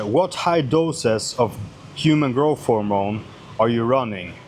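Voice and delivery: usual speaking voice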